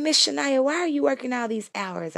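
put-on high child voice for the kids asking; mock kids asking why; imitating the kids. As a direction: pitch way up like a curious kid. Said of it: "the kids that I work for".

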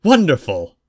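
loud, bright exclamation of joy; delighted. Acted. Pandora cheering the betrothal acceptance, loud delighted cry. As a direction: burst out with delight.

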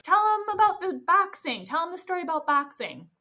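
high, loud heckle from the crowd; acted shout of encouragement; yelling. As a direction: yell it up to a friend on stage. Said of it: "then Gladys yells".

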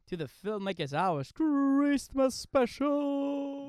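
high, slow, shouted announcement; big announcer shout. Acted. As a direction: belt it like a showman opening night. Announcer opening the Christmas special.

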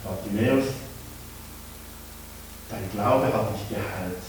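soft, low gentle words; gentle, tender. Acted. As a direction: speak softly, kindly. Jesus speaks gently.

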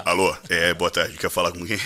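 deep, businesslike disguise; fake manager voice. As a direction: pose as your own manager answering calls. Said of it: "Então eu mudava a voz".

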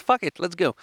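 excited. Retold or imitated. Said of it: "So I said".

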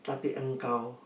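measured prayer with reverent pauses; earnest prayer. Real, not acted. Preacher leads congregation in thanking God, earnest prayer. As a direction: address God humbly and earnestly.

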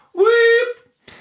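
sudden squeal way up high; high squeaky call. Pretend. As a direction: squeak the name out high and loud. Calling out to listener.

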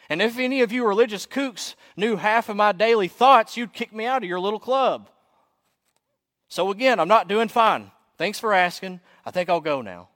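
loud, high-pitched confession to the whole room; acted yelled outburst. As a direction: yell it out, fed up with pretending. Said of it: "I yell out to everyone that can hear".

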